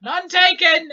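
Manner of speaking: loud, high-pitched shout; high called-out reply. Acted. Drifting character answering Shannara's slight, shouting high. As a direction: call it out at the top of the range. Called out while floating away.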